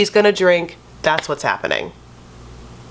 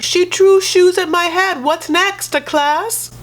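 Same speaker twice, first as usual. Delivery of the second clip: mock Jesse accent; 11 semitones higher, slower than usual. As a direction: exaggerate his accent in outrage. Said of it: "Jesse's like".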